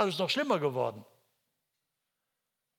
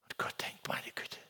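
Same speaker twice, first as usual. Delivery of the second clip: whispered; 9 dB quieter than usual. Acted. God's inner thought, whispered.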